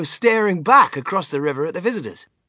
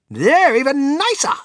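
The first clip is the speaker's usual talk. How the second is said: high, loud enthusiastic boast; excited cry; 12 semitones higher, 8 dB louder than usual. A narrator's reading. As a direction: exclaim it with great relish. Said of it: "cried Mister Wonka".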